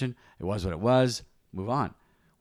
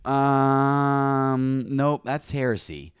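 long drawn-out, higher-pitched um, then slow dismissal; skeptical refusal; 4 semitones higher, 4 dB louder, much slower than usual. Retold or imitated. Believer rejecting his own idea of God, mock skeptical. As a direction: stall on the um, then reject it deliberately.

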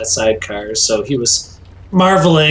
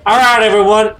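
megaphone voice; 5 semitones higher than usual. Acted. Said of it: "find a megaphone effect to put on your voice".

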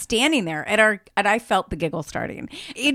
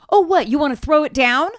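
high, loud retold provocation; raised-voice taunt; mock challenge; 5 semitones higher, 5 dB louder than usual. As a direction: imitate someone picking a fight. Imitates man challenging to fight.

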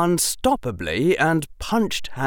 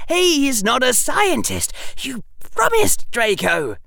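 high, louder alarmed plea; 6 dB louder than usual. Acted. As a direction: beg him, panicking. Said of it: "his voice rising in alarm".

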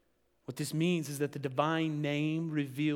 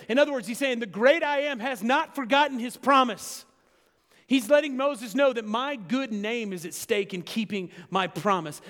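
rising, much louder and higher preacher's cry; preaching at full force; 7 semitones higher, 8 dB louder than usual. Acted. Preacher driving home God's promise, loud and high. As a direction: build the sermon to a ringing peak.

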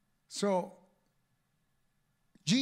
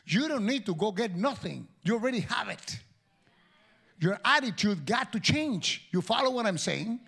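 loud, higher exhortation; preaching at full force; 4 semitones higher, 4 dB louder than usual. Acted. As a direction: press the congregation hard.

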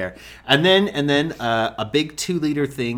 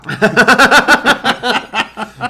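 big burst of laughter; loud laugh; 11 semitones higher, 10 dB louder than usual. Real, not acted. Laughs at police story.

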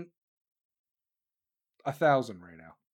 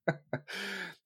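soft, high chuckle; 4 semitones higher, 10 dB quieter than usual. Real, not acted. Host amused by own soap opera joke, soft chuckle. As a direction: let out a quiet laugh.

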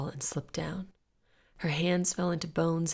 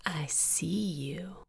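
slow, drawn-out creepy whisper; menacing whisper; 5 dB quieter, much slower than usual. A narrator's reading. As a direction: whisper it slowly, just loud enough to hear. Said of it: "he whispered, just loud enough to hear".

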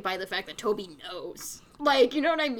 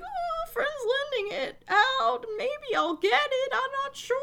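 much higher, meek, evasive voice; sheepish Snake; 12 semitones higher than usual. Retold or imitated. Snake dodging Spike's question, high and meek. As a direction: squeak it out like a caught husband. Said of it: "Spike's like".

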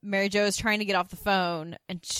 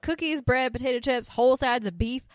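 higher and louder, a huffy mock diva; imitating Suzanne; 5 semitones higher, 4 dB louder than usual. Suzanne scolding the others over her rice cakes, in a huffy mock diva voice. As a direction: act the wronged dieter with a lot of drama. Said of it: "She's like".

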